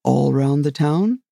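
ordinary delivery, nothing marked

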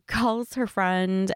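usual speaking voice

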